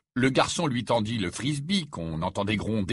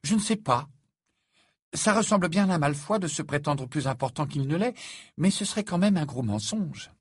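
hesitant higher-pitched character voice; uncertain; 7 semitones higher than usual. A narrator's reading. Hermione weighing up Harry's theory, uncertain. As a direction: sound unsure, voice raised and lifting. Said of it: "l'air incertain".

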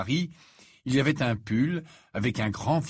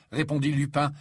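plain reading voice

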